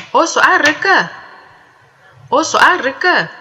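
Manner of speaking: Oswa reka high and loud, falling on reka; high tone question. Shown as a demonstration. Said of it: "The questions are posed in the high tone".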